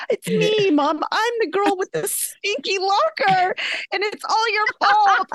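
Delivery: high, accusing mock wail; hammed-up distraught kid voice; upset daughter. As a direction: imitate a mortified preteen blaming mom. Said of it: "really upset".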